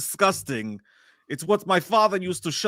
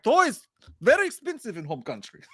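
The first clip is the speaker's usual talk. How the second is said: higher, light Russian dad voice; mock Russian accent; 7 semitones higher than usual. Dad teasing his kids in the car in a Russian accent. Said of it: "I do it to the kids".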